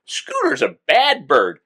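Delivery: high, slow squawking parrot talk; talking cockatoo imitation. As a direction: mimic a bird scolding itself, stretched out. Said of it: "he'd go".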